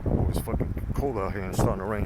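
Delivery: usual speaking voice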